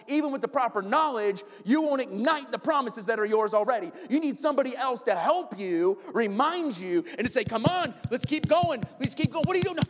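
high, loud sermon build; preaching at full force. Acted. Pastor urging the church to encourage each other, fervent preaching. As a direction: exhort them to keep going.

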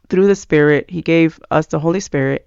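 normal talking voice

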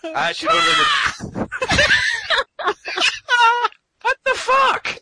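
very high, loud laughing shriek; hysterical laughing. Real, not acted. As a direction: lose it laughing.

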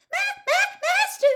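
very high, loud squeaky stammer. A narrator's reading. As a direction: stammer the word out in a piercing, pleading squeal. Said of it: "Winky stammered".

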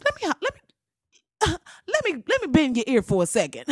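mock sweet-talking auntie; Maxine buttering up. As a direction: pull them aside with sly charm. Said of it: "Maxine Waters came by the office".

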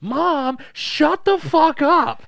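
put-on high, loud, drawn-out teen yell; hammed-up screech at a parent; mock furious shout. Daughter in the bath yelling at mom, mock fury.

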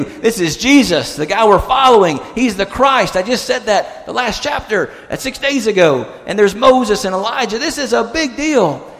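high, excited disbelief; amazed disciple. Retold or imitated. Disciples realizing who stands with them on the mountain, amazed.

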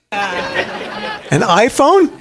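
very slow, high, laughing through it; amused laughing aside; laughing question. Real, not acted. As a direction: toss out the joke and crack up. Joking answer to luxury question.

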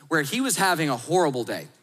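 ordinary talk

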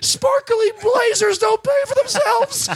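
high, slow mock wail; hammed-up high protest, drawn out; whining promoter. Promoter excusing the missing money, mock wailing.

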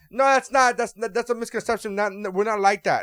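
high, loud mock protest; mimicking a pushy stranger's high, loud denial; defensive protest.

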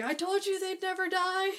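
high, shrill told-you-so yell; mock told-you-so. Emmett quoted coming back, high told-you-so. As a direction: crow it in a high vindicated voice. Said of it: "he's like".

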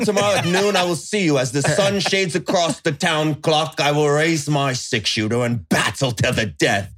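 put-on grand old-west showdown speech; dramatic gunslinger. Mock gunslinger duel speech.

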